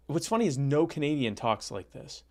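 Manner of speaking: normal talking voice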